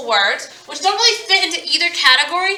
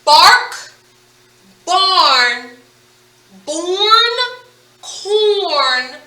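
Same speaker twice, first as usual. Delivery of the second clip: louder, higher chain read word by word; reading chain aloud; 5 semitones higher, 4 dB louder, much slower than usual. Shown as a demonstration. Teacher leading class reading the word chain, loud and clear. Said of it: "You ready to read our chain?".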